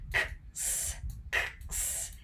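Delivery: X soplada, sin voz, como ks; sonido ks aislado. Shown as a demonstration. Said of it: "Tienen dos sonidos". (la X susurrada como ks)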